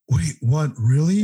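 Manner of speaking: mildly incredulous retold reaction; taken aback. Doug hears about the falling-out, taken aback. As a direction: imitate a friend caught off guard.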